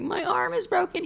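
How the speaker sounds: very high, hammed-up whimper; fake whimper. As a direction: keep faking the broken arm. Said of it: "I'm like".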